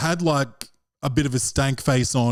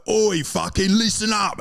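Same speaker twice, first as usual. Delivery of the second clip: put-on Cockney accent; 6 semitones higher than usual. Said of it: "do the Cockney accent on stage".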